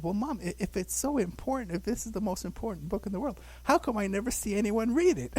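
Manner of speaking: put-on high, earnest little-kid question; child questioning mom. Child self challenging mother, high and earnest. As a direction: ask like a puzzled five-year-old. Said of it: "I said".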